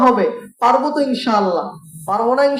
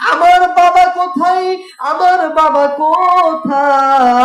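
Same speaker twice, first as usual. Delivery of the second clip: high, loud cry for his father, the second drawn out slowly; despairing child; 6 semitones higher, 6 dB louder than usual. Acted. Disheartened little boy cries out for his father, high and loud. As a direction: wail for the missing father. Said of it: "সন্তানটা হতাশ হয়ে গেল".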